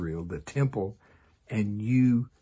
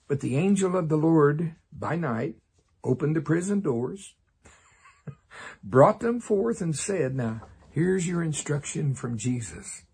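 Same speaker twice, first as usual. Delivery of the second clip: ordinary talk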